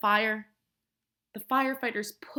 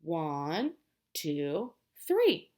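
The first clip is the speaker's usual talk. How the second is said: counting aloud; 6 semitones lower, much slower than usual. Shown as a demonstration. Teacher counting sleeping firefighters with children, lifting the end. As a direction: count slowly, then lift the final number. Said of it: "Let's count".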